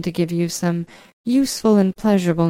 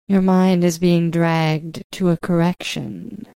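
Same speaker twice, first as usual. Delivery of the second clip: usual speaking voice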